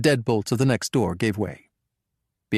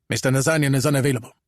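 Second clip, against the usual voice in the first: curt Armenian guard; 2 semitones higher, faster than usual. Acted.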